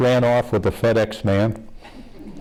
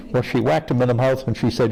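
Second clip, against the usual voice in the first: normal talking voice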